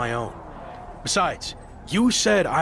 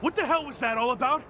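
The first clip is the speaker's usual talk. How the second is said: raised, pitched-up angry questions; angry confrontation; 5 semitones higher, 5 dB quieter than usual. Acted. Betrayed champion confronting his teammates, angry and high. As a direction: demand answers, higher and heated.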